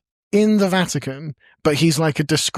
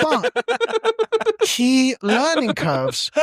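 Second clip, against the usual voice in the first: slow, drawn-out and higher, like a smug mentor; mock wise drawl; 11 semitones higher, much slower than usual. To the co-hosts, mock wise drawn out. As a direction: stretch it out as if passing on hard-won wisdom. Mock wisdom about bad investments.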